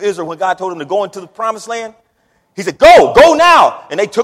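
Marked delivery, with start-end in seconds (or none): 2.8-3.9 high, loud and slow command; commanding call. Acted. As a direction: call them out with authority. God's command retold.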